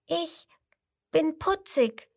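high squeaky little voice; tiny high call; little crocodile calling. A narrator's reading. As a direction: pipe it up like a baby crocodile. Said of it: "ruft das fünfte".

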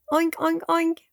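very high squeaky oinks; excited piglet oinks. A narrator's reading. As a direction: oink while hopping with joy. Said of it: "hüpft ganz aufgeregt ... und macht".

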